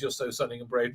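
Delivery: low, soft offhand praise; understated quote. Retold or imitated. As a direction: say it down low, without fuss. First take, before correcting delivery.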